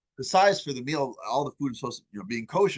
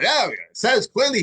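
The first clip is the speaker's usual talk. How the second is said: proud; 6 semitones higher, 5 dB louder than usual. Retold or imitated. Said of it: "he's like all proud".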